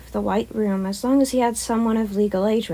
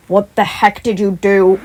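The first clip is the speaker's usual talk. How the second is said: louder, slowed startled question; 10 dB louder, slower than usual. Acted. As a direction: blurt it out while driving. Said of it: "Aizawa asked".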